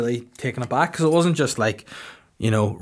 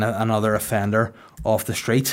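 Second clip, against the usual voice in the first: ordinary talk, nothing marked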